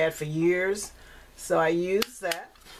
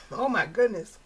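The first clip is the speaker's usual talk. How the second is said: choking on a cough; 2 semitones higher than usual. Real, not acted. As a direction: speak while choking up. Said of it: "I'm choking".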